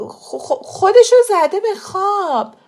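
stuttered start bursting out high and loud; stammer, then blurt it out; flustered stammer. A narrator's reading. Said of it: "با تته پته گفت".

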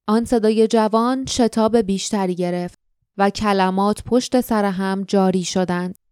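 usual speaking voice

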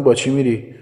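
loud, high shout after someone leaving. Acted. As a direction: call out urgently from a distance. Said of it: "داد زدم".

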